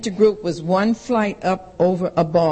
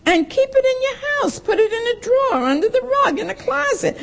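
much higher, mocking mother's orders; 13 semitones higher than usual. A daughter mimicking her strict mother. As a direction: imitate mother laying down the rule.

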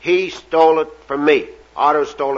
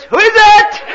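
piercing high parrot screech; 8 dB louder than usual. Acted. Parrot still questioning the plumber, piercing loud screech. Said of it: "Parrot says".

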